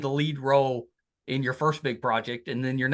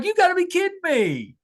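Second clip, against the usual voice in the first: much higher, louder cry of exasperated disbelief; mock disbelief; 5 dB louder than usual. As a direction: throw your hands up, you can't believe it. Father's reaction to son's turnaround.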